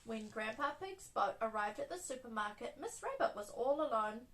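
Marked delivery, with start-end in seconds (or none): none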